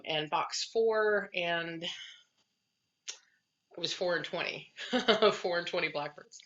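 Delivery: normal talking voice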